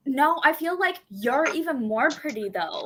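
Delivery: put-on gushing Valley Girl compliment. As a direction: flatter a girlfriend in Valley Girl talk. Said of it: "talk like this all day".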